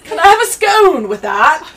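put-on loud, high, drawn-out British accent; over-the-top British voice, slow and high; mock British accent. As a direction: belt it out in exaggerated British. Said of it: "I'm over here talking British".

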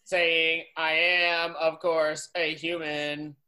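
low, level robot monotone; stilted robot voice; robot posing as human. Acted. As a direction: intone it like a robot. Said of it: "robots don't exist in their world".

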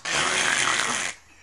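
loud, voiceless hacking noise; imitated cough. Retelling a man at Walmart, loud imitated coughing. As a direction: fake a loud uncovered cough. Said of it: "coughing and fucking sneezing".

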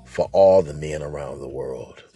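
low, soft mock tribute; solemn dedication. Addressing all men, a low solemn dedication.

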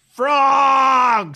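loud, high, drawn-out shout; stretched, high-pitched holler; mock yell. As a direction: bellow it like a heckler at the back. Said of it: "did he yell".

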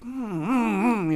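high reluctant mm mm hums; grumbling hum. Retold or imitated. Grudging hums after hearing he must start over. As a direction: hum your grudging acceptance. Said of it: "So I'm like".

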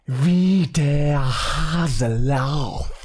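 extremely drawn-out German vowels; slow German phrase reading. Shown as a demonstration. Host repeating the German phrase for his cohost, exaggeratedly slow. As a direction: drag every word out for comic weight. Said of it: "casually and calmly read very common German phrases".

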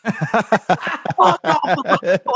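slow, high repeat broken by laughter; laughing repeat. Retold or imitated. Laughing while repeating the bandmate's order, slowed. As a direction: laugh through the quote again. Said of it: "Cody immediately goes".

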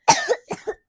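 loud, high fake cough; pretend cough. Parent asked child to cough along, loud pretend cough. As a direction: fake a big short cough. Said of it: "Can you cough for me?".

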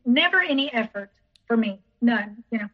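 normal talking voice

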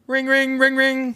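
high sing-song ring, ring; phone ringing. Pretend. Announcing the wife's friend calling, high phone ring.

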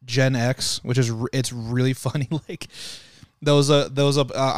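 normal talking voice

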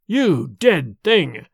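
slow, scornful accusation; contemptuous. A narrator's reading. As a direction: spit contempt at the corpse slowly. Dorda confronts vampire father.

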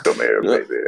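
very low hammed-up seducer voice; mock seductive. Player beckons a lover as a joke, mock seductive. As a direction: beckon in a deep, joking croon.

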